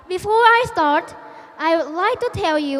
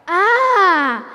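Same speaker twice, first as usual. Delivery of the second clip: long, loud "aah"; stretched exclamation of sudden understanding; acted "ah" of realisation; 5 dB louder than usual. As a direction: draw out a loud knowing "ah". Acted engineer's moment of realisation.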